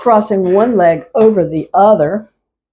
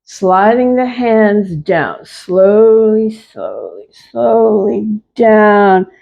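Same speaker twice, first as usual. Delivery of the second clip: slowed right down, each word stretched; slow motion. Shown as a demonstration. Teacher slowing the class's moves down, slow and drawn out. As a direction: guide the class at a crawl. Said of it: "One more at the slow".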